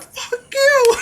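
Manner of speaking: high mock yell; hammed-up high-pitched retort; crowd heckle. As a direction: snap back as a defiant crowd. Crowd yelling back at DJ.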